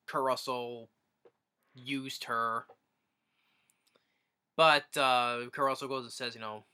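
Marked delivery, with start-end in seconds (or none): none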